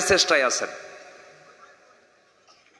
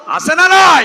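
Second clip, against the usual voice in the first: loud, high shout to the crowd; shouted challenge; 10 dB louder, slower than usual. Real, not acted. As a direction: shout for their agreement. Preacher demands answer from crowd.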